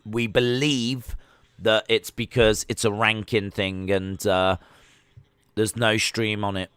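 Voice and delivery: ordinary talk, nothing marked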